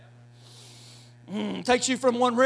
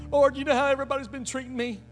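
mock whining prayer; 4 semitones higher than usual. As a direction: whine to the Lord like a sulking victim. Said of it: "He ain't listening".

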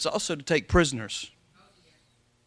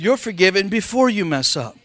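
"You're forgiven before you mess up" said louder, with force; louder repeat; 3 semitones higher, 4 dB louder than usual. Shown as a demonstration. Pastor repeating the line to get amens, louder. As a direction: proclaim it for the shouting. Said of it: "I said".

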